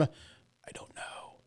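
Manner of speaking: barely audible, slow whisper; whispered aside. Real, not acted. Host to himself while listing articles, whispered aside. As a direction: whisper it under your breath, slowly.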